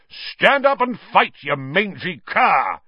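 high, slow, blustering knight's challenge; Sir Cadogan yelling. Acted. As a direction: bellow it like a pompous old knight. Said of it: "yelled Sir Cadogan".

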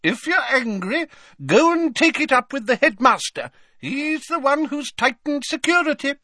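high haughty lady voice; Fat Lady voice. Acted. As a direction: play a prim, put-out portrait lady.

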